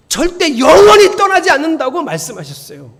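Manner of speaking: high, loud and insistent; ringing declaration; emphatic preaching. Real, not acted. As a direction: proclaim it forcefully to the congregation. Preacher drives a promise home.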